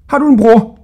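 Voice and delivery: high, loud questioning; baffled old jailer. A narrator's reading. Baffled jailer questioning Hodja, high and loud. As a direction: blurt out the question.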